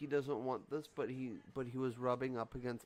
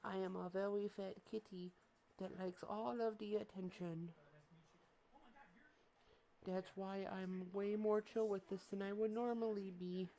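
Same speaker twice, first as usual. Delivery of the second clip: high, soft cat voice introducing himself; voicing the cat; 8 semitones higher, 8 dB quieter than usual. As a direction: speak for the fat cat. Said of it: "Can you wave hello?".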